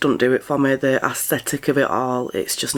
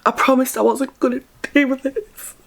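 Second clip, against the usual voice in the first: high whiny mock of a crier; imitating a crying influencer, much higher; mocking teary quote; 11 semitones higher than usual. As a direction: parody someone fighting tears. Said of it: "Don't be like".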